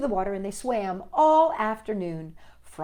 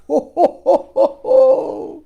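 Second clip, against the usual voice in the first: big loud belly laugh; Frog's laugh; 8 semitones higher, 14 dB louder than usual. Acted. Frog laughing loudly at Toad. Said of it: "And Frog laughed".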